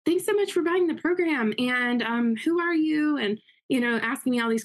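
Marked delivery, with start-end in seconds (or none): 0.0-3.2 imitating a cheery caller, pitched much higher; chirpy, high voice as she phones the new buyer; Lisa calling. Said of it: "It's like".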